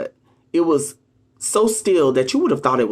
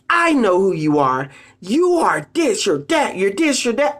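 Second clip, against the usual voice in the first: confronting accusation; 5 semitones higher than usual. Retold or imitated. Confronting a narcissist, a mild mock accusation. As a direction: call them out to their face. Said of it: "go to their face and say".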